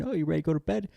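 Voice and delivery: high, sing-song innocent question; sweet fake innocence. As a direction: ask sweetly as if nothing's wrong. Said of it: "which is why I subtly".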